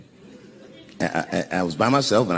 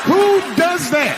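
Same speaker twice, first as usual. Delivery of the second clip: very high, slow, incredulous cry; outraged disbelief; 3 dB louder, slower than usual. Acted. Comic appalled that his wife opened the box, outraged squawk.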